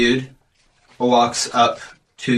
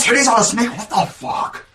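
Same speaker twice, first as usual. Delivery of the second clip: jock muttering; 8 semitones higher than usual. Acted. Jock mutters walking away.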